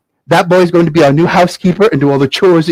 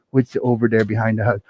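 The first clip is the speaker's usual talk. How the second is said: low, very soft muttered question; quiet wondering; 7 semitones lower, 9 dB quieter than usual. Acted. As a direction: mumble it to oneself as the mother. Mother turns to look.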